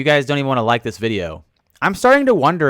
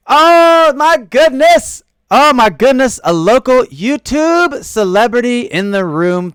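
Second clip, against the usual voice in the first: high, loud delighted gush; excited; 11 semitones higher, 6 dB louder, slower than usual. Real, not acted. Streamer greeting a YouTube celebrity in chat, loud and delighted. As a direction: gush over a surprise guest.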